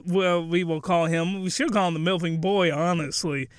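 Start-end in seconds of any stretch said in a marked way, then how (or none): none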